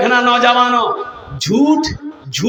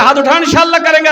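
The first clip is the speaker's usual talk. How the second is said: rallying call; 6 semitones higher, 6 dB louder, much faster than usual. Real, not acted. Rallying the crowd.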